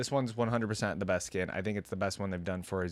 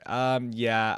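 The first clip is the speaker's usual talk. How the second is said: usual speaking voice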